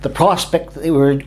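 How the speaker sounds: usual speaking voice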